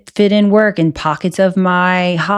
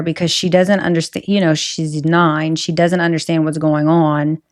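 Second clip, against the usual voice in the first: normal talking voice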